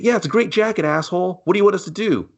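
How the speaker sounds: high put-on snark; mock a sneering character up high; a high, sarcastic mock answer; mock reply. Mocking a movie character.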